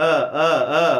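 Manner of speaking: আ repeated as separate, loud vowels; teacher loudly demonstrating the vowel আ; isolated vowel sound. Shown as a demonstration. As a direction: punch out each আ on its own. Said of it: "তাহলে syllable যখন বলছি গ".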